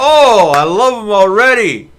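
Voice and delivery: loud, gleeful outburst; delighted. Real, not acted. Streamer thrilled by the foul-mouthed dwarves, loud delighted cry. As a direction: gush with real delight, loud.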